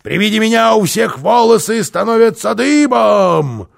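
high, loud spooky witch cry; shouted witch boast. A narrator's reading. Карлсон tries to scare boy, loud witch voice. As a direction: boast at full voice to terrify.

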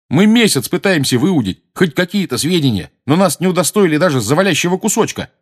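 high, loud aggrieved outburst; shouted complaint. A narrator's reading. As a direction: cry out in protest. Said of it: "крикнул Джордж". (возмущённый крик)

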